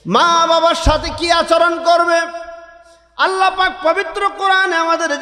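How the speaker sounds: high, impassioned sermon delivery; fiery waz preacher calling out; preaching at full force. Acted. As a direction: preach it out to the whole gathering.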